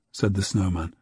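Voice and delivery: ordinary delivery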